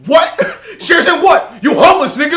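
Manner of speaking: loud, high mock outrage; laughing impression of an indignant shout; imitated yelling. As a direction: yell it like a friend's incredulous rant. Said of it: "you were doing that whole, like".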